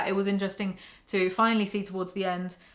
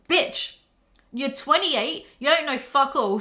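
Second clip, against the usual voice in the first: louder, higher, heated rant; getting mad; 5 semitones higher, 4 dB louder than usual. Real, not acted. Reviewer ranting at Henry, angry and loud. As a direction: tear into the character for real. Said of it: "Why am I getting so mad?".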